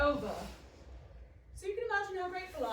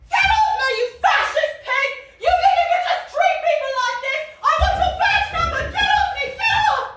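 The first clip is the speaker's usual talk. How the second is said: shrieking at the officer, very high and loud; a full-throated enraged yell; furious screaming; 16 dB louder than usual. Acted. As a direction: scream it like you're being pinned down. Struggling under a policeman.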